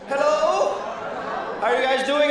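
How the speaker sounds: normal talking voice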